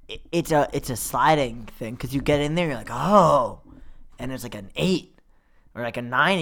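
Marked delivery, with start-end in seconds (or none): 2.9-3.6 "Oh" as a small sound of wonder; mildly impressed. Acted. Visitor just arriving at an art museum, mildly impressed. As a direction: let out an interested oh.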